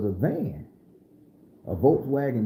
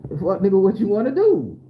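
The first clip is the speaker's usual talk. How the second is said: retold challenge; 5 semitones higher, 6 dB louder than usual. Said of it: "it was like".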